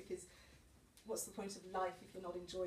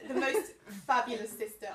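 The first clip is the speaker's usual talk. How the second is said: laughing; 4 semitones higher, 9 dB louder than usual. Real, not acted. Still laughing from joke.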